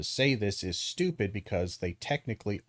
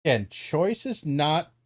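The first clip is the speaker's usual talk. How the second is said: ordinary delivery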